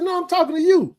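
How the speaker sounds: high, heated confrontation; retold furious challenge. Confronting the boy outside, retold angry demand. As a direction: get in his face, demanding. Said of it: "I was livid".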